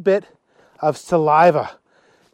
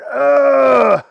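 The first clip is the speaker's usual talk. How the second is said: loud, high, long "ugh"; acted cry of revulsion; disgusted groan; 8 semitones higher, 6 dB louder than usual. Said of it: "Lynn and Alexander went".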